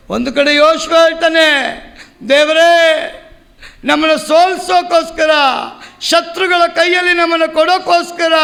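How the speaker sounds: sustained pulpit cry; impassioned preaching. Acted. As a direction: preach it as a pleading lament to God.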